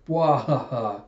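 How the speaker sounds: hammed-up bwahaha; mock belly laugh of a prankster; imitated laugh. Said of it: "Yasue laughing when it was just a prank".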